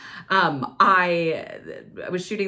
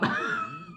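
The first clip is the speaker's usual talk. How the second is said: high squeaky laugh; 6 dB quieter than usual. Real, not acted. Laughing at her ex's question.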